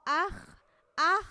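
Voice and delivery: normal talking voice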